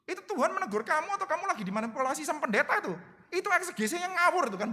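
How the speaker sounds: high, needling "wait a minute"; teasing pushback to a credulous listener; teasing challenge. Retold or imitated. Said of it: "kepengen menggelitik".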